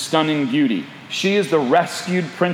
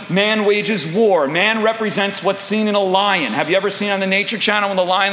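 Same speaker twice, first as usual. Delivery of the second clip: ordinary talk, nothing marked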